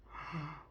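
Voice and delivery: short, quiet gasp of shock; mock gasp. Imitating fans gasping at the news, a quick mock gasp.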